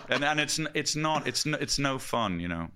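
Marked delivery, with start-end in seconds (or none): none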